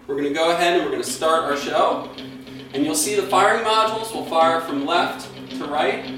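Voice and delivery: normal talking voice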